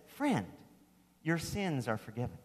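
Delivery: hushed gentle declaration; quiet retelling. Shown as a demonstration. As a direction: say it almost under your breath. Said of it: "Jesus, this master teacher ... says".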